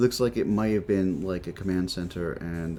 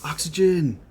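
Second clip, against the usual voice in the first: excited; 9 semitones higher, 8 dB louder than usual. Real, not acted. Relief at finding oxygen.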